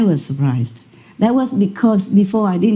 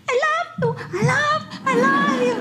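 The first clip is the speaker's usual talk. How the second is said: much higher, softer imitation of the talking dog; 7 dB quieter than usual. Imitating the dog saying it to its owner, high and thin. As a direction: mimic a dog's whiny attempt at words. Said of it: "four or five times the words".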